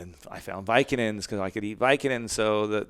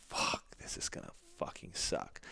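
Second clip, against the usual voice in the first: whispered dread; 13 dB quieter than usual. Retold or imitated. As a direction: whisper it, much softer. Throwing away pills, dread.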